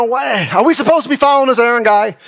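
lightly voiced complaining Israelite; doubting Israelite. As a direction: whine it as a doubting boy. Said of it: "uh, you know".

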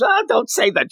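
high-pitched retold girl's objection; mock embarrassed protest. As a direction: lightly act out a flustered protest.

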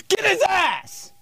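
high, drawn-out mock shout; imitated yell. Comedian yells after his tire slasher, high mock shout. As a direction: holler it high as a mock chase cry.

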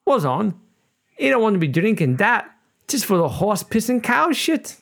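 high-pitched Cornish farmer, badly faked accent. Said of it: "this joke because it requires an accent".

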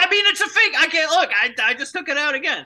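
high, loud defensive outburst; worked-up, high-pitched excuse; flustered protest. Pretend. Defending himself over the fake tooth.

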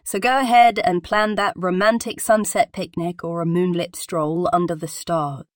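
ordinary talk

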